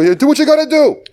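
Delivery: very high excited holler; mock trainer's shout. Podcaster as the trainer advising a drunk, high mock yell. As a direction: yell it high like a useless cornerman.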